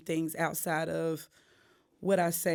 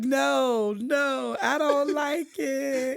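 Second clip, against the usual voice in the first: high, loud, slow, whining "no, no, I don't like it"; mock whiny refusal; 8 semitones higher, 4 dB louder, much slower than usual. Singer mimicking her studio self, high whining refusal. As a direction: whine like a reluctant child.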